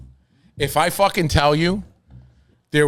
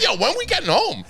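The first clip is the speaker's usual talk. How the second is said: much higher, laughing as he asks; laughing question; 11 semitones higher than usual. Retold or imitated. Lost in the car with Dave, high and laughing. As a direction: giggle out the complaint.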